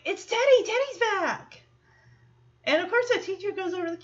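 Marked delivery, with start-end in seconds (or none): 0.1-1.6 high-pitched, thrilled exclamation; high mock cheer for a returning friend; acted excited announcement. Voices friend thrilled at Teddy's return.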